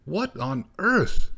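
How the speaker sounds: high, slow astonished cry; exclaiming. Acted. Gorsh exclaims at the cat's tomato gift in surprise. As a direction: caught off guard, startled. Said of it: "exclaimed Gorsh".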